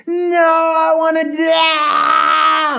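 high, long drawn-out mock bawl; tantrum wail. Nine-year-old refusing family time, wailing. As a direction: imitate a child howling in protest.

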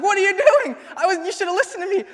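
high, loud panicked scolding with a laugh in it; retold instructor yelling at the student who hit the pole; mock-panicked yell, fast on the second line; freaking out. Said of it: "then she freaks out".

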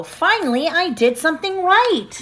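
happy dog voice. A narrator's reading.

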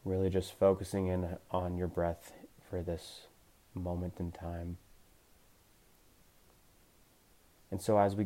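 gentle meditation coach voice; guided meditation. Acted. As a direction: ease them into calm awareness, unhurried. Leads a guided body scan.

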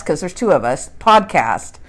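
normal talking voice

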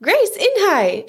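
put-on excited kid's high-pitched call; shouted. A narrator's reading. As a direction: shout it out with glee, words stretched. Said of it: "shouted Ralph".

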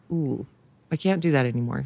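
usual speaking voice

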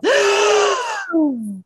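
pearl-clutching gasp. Retold or imitated. Mocking an anxious person's overreaction, pearl-clutching gasp. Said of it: "the gasping and clutching of pearls".

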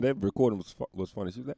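ordinary talk

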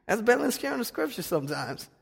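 ordinary talk, nothing marked

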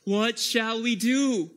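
high, loud, slow desperate plea; anguished cry. Acted. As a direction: cry it out to the apostles. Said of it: "they say".

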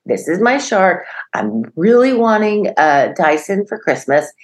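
put-on eager five-year-old listing favorites; imitated proud little boy. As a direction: lightly play a kid presenting prized treasures. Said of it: "he opens his closet and he's like".

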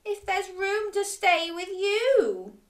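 high, hopeful-sad dragon plea; sad, sniffly storybook dragon; sniffling. A narrator's reading. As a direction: ask shyly in a high, forlorn voice. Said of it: "sniffed the dragon".

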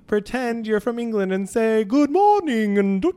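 mock posh English singer warming up; a light English accent for choir; British choir voice. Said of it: "sing like a British person".

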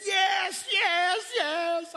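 high, loud, crying yeses; drowning man crying yes; frantic yes. Retold or imitated. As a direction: blurt it out in terror. Panicked man answering.

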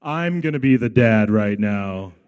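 loud, low declaration from an angry mom; mock angry mom. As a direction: boom it out like she's taking over. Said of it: "She's gonna go".

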